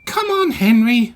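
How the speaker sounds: high, loud hurried urging; puffing impatience. A narrator's reading. Small engine in a hurry urges Henry, impatient. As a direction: hurry him along, pitched high. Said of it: "Percy puffed".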